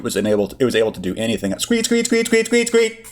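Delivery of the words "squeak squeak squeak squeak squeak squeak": squeaky electronic alarm beeps, very high; high alarm squeaks. A narrator's reading. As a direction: chirp it like a shrill proximity alarm. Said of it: "The shriek of a proximity alarm".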